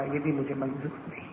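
low, soft, sinking refusal; quiet refusal. A narrator's reading. Daughter's quiet refusal, narrated. (دھیمی، نیچی آواز میں انکار)

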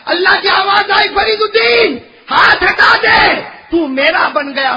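high, slow shouted proclamation; full-force preaching. Acted. Preacher voicing Allah's call to the saint, triumphant shout. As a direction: stretch out the divine call at full voice.

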